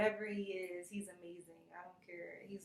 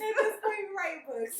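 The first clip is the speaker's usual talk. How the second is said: high, loud laughing fix of the wording; giggling through the correction; 12 semitones higher, 13 dB louder than usual. Real, not acted. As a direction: laugh as you correct yourself. Laughs correcting her grammar.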